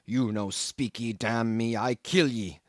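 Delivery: 1 threatening cannibal. A narrator's reading. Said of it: "And so saying".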